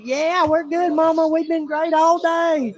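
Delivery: high, loud mock-innocent reassurance, last words drawn out; fake all-is-well. Brothers covering for a fight to Mama, fake all-is-well. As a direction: overdo the good-kid act.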